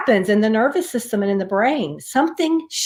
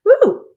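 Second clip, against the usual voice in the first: high breathless woo after coughing; recovering gasp; 13 semitones higher, 5 dB louder than usual. Real, not acted. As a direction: catch your breath after choking. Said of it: "it went down the wrong way".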